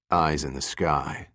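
low, soft, slow grumble; grumbling. A narrator's reading. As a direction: mutter it, unimpressed. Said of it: "Giordino grumbled".

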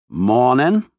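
cheerful Western twang; friendly cowboy greeting; Western accent. A narrator's reading. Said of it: "he said cheerfully".

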